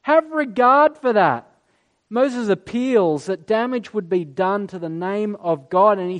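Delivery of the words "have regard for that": slow, much higher, insistent plea; higher, slow earnest appeal; pleading. Retold or imitated. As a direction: remind God of his promise, urgently. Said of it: "He goes to God, he's like".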